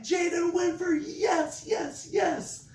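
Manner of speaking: very high, excited praise; squealing high teacher celebration; celebrating. Retold or imitated. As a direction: cheer him on like he just scored. Teacher celebrating student's word.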